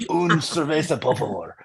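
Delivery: suave Spanish ordering voice; smooth, exaggerated Spanish accent. Shown as a demonstration. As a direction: put on a dropped Spanish-speaker voice. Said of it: "why did I drop an octave?".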